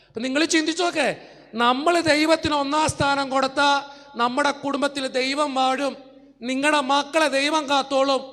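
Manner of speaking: loud, rising preacher's call to the crowd; preaching at full force. Acted. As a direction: drive it home to the congregation. Preacher exhorting congregation. (ഉച്ചത്തിൽ പ്രസംഗിക്കുന്നു)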